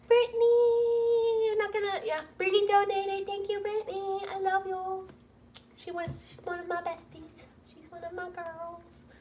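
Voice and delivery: high, thin voice held back from tears; choked-up affection. Real, not acted. Moved by close friend's donation.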